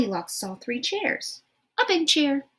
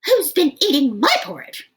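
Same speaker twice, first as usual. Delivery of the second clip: medium-sized shout; 6 semitones higher, 8 dB louder than usual. Acted. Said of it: "shouted Mama Rhino in her medium-sized voice".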